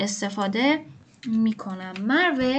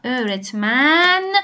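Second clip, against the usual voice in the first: slow stretched demo word; much slower than usual. Shown as a demonstration. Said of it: "teacher stretches the word out slowly".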